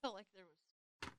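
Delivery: talking with mouth full; speaking through a bite of food. Real, not acted. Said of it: "Sorry, I'm eating on the podcast".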